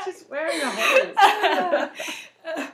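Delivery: slow, high, breaking into laughter; laughing repeat. Real, not acted. Repeating the misread line to friends, laughing. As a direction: savour the silly phrase laughing.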